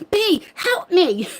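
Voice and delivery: slow, high pleading cry for help; mock plea. Streamer mimicking herself begging B for tech help. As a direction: whine it like a helpless student. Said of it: "I'm like".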